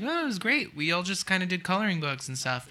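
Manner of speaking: high bubbly carefree voice; cheery friends voice. Retold or imitated. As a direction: chirp it like it was all fun. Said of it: "friends who were like".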